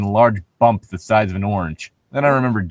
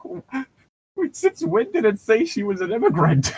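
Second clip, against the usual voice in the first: much higher, bewildered quote; high confused squeak; 10 semitones higher than usual. Retold or imitated. Player retelling their confusion about ICE, high.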